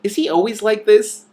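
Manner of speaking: high, incredulous question. A narrator's reading. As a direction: ask in mock disbelief. Prince asks, amused.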